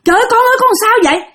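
high frantic cry of a panicked mother. Retold or imitated. Mother finds her child hanging, cries out in panic. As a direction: call out in alarm, pitch jumping up. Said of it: "mẹ la".

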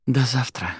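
a touch of a wistful sigh; sighed goodbye. A narrator's reading. Girl parting from the librarian, lightly sighed. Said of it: "вздохнула Арадия".

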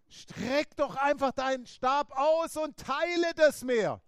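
God spelling out the obvious; God instructing. Retold or imitated. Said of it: "Er hat gesagt".

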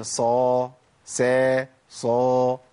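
صا heavy and dark, سا light and thin; Arabic sad then sin; sad versus sin. Shown as a demonstration. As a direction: contrast صا emphatic with سا open. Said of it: "غير الصاد أقول إيه".

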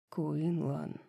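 low, hushed murmur of a name; soft, low, awed murmur; whispered. A narrator's reading. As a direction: breathe the name softly, in amazement. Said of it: "прошептал Хант".

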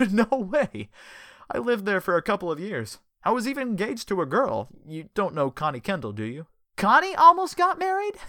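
high, loud delighted disbelief; excited. Acted. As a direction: bubble over with happy surprise. Richard excited in story.

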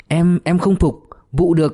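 mildly acted tongue-tied fright; flustered stammer. A narrator's reading. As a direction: stumble over the words, tongue-tied. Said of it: "miệng ríu lại".

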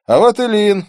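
loud, high-pitched exclamation; exclaimed. A narrator's reading. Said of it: "воскликнул частный детектив".

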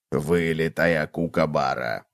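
low, slow, soft remark; quiet low cockroach voice; observing cockroach. A narrator's reading. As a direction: comment drily, low and drawn out. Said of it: "заметил таракан".

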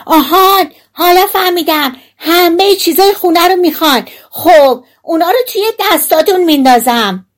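high, loud tiny character voice; shouting. A narrator's reading. Tiny hero promises thieves their loot, shouting high. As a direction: holler it in a piercing little voice. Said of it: "بند انگشتی دوباره فریاد زد".

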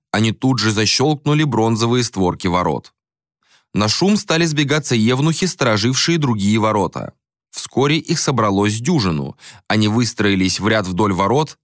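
ordinary delivery, nothing marked

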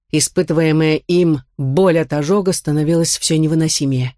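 ordinary delivery

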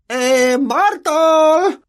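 high, loud calling shout. A narrator's reading. Peeves yelling for Myrtle, high and loud. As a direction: call her name across the room. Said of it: "Tomó aliento y gritó".

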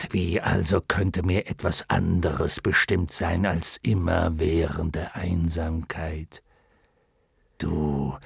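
low, slow, solemn lion; lonely lion voice. Acted. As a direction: speak low as a lonely beast.

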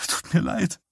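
high, choked voice on the edge of tears; a tearful, high-pitched apology. A narrator's reading. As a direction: break into tears on the words. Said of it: "und er brach in Tränen aus".

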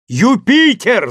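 a long call to someone far above; drawn-out shout. A narrator's reading. Legrand shouting up the tree to Jupiter, drawn out. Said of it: "закричал он".

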